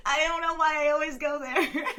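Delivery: embarrassed laughing. Real, not acted. Joking about smelling teenagers, embarrassed laughter. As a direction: giggle through the embarrassment. Embarrassed by her own joke.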